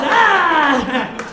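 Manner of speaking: high, loud, drawn-out yell of frustration; a T-Rex who can't get enough; comic frustrated dinosaur scream; grumpy T-Rex cry. Acted. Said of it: "And that's not enough, just".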